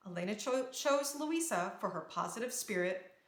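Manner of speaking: ordinary delivery, nothing marked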